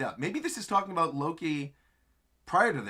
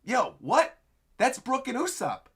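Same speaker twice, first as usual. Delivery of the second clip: higher, startled questioning; surprised crew; 7 semitones higher than usual. Retold or imitated. Crewmates surprised to see Luffy. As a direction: react like you can't believe it. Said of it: "And they're going".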